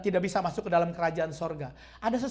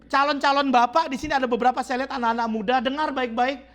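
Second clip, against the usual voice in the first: louder, urgent warning from the pulpit; fiery preaching; 5 semitones higher, 5 dB louder than usual. Acted. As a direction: drive the warning home to the men. Preaching warning to young men.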